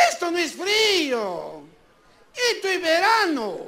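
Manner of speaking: slow, drawn-out scoffing; mock protest. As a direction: stretch the key words in exaggerated disbelief. Scoffs at audience feeling cold.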